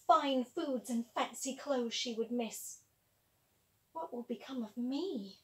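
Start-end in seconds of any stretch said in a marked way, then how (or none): 3.9-5.4 quiet worried thought. Acted. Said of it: "thought Vasilisa".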